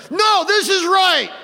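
high, drawn-out mock shout of certainty; threatening bluster. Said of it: "raise their voice in a threatening manner".

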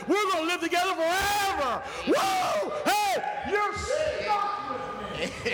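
high, exultant shouted preaching; full-force preaching. Real, not acted. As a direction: cry it out to the congregation, stretching key words.

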